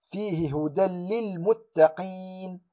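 هدى للمتقين recited slowly, tanween merged into doubled lam; slow Quranic recitation, hudal-lil; tajweed recitation. Shown as a demonstration. Teacher reciting the example, slow merged tanween. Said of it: "أقول".

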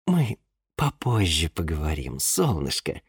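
low, soft, holding back; calm yourself before you answer; restrained calm. Acted. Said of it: "сделав глубокий успокаивающий вдох". (сдержанно и тихо)

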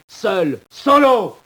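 « Solo » hurlé très haut et fort; cri de solitude. Acted. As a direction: monte jusqu'au cri. (cri aigu et fort, isolé)

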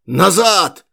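loud hoarse barked order; hoarse shout. A narrator's reading. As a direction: yell it back at the crowd, hoarse. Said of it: "закричал он сиплым голосом". (сиплый крик)

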